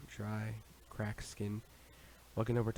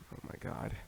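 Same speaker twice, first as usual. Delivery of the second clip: whisper; 5 dB quieter than usual. A narrator's reading. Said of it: "was all I could come up with".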